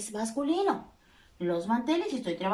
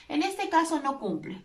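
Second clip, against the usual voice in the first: usual speaking voice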